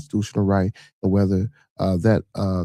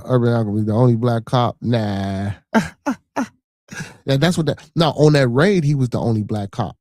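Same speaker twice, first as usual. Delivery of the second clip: ordinary talk, nothing marked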